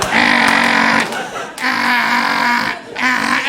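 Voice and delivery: strange strained voice cries; imitated stammer sounds. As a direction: mimic Bobcat's trademark yelps. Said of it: "do an impression of me".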